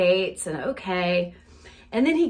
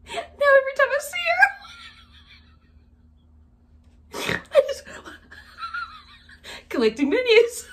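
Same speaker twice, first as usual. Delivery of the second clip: high, loud squeal through laughter; helpless laughing; 4 dB louder than usual. Real, not acted. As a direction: barely get words out for laughing. Laughing too hard to finish.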